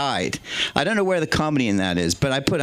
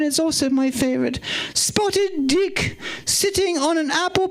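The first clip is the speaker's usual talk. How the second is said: the Queen's voice; 12 semitones higher than usual. Retold or imitated. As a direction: stay in the high Queen impression while reading the menu. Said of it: "do it in the Queen's voice".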